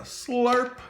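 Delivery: high, drawn-out slurp; slurping sound effect. Shown as a demonstration. Teacher acting out the sound word for kids, high slurp.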